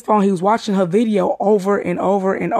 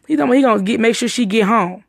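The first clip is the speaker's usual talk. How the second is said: usual speaking voice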